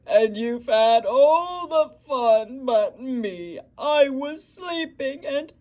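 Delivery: loud, slow, wailing cry; whimpering bear. Acted. Bear cries to the friends who partied without him, wailing. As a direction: wail like a big bear left out. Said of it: "he whimpers, and he moans".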